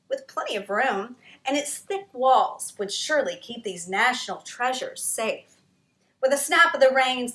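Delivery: ordinary delivery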